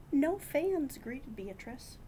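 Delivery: ordinary delivery, nothing marked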